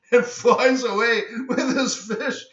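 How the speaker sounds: high, laughing words; laughing while telling. Real, not acted. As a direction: tell it through amused laughter.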